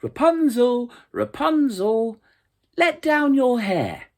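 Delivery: high, loud call up the tower; a young prince calling loudly up to a window. A narrator's reading. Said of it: "he went to the tower and called".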